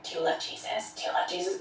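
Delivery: hushed, low whispered question; imitated whisper. Man whispering to the woman he pinned down, imitated whisper. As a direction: whisper it insistently, repeating the question. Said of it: "he started whispering".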